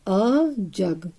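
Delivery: very slow, each sound stretched; slow dictation. Shown as a demonstration. Teacher reading back the sentence to kids, very slow. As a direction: draw it out for young writers.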